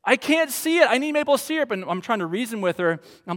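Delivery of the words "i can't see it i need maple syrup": imitating an upset little girl, much higher; mock toddler protest, high and insistent; hammed-up child complaint; whining daughter. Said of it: "And she goes".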